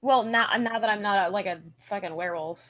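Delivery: ordinary talk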